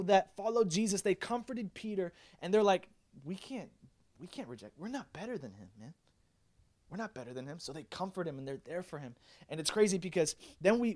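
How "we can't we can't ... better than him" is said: soft, hushed acted reassurance; gentle acceptance. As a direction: quietly admit it, sincere and humble. Imagined disciples comforting Peter.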